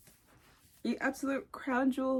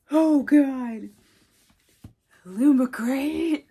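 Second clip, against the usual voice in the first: 1 loud gush; 10 dB louder, much slower than usual. Real, not acted. Gushing over special covers.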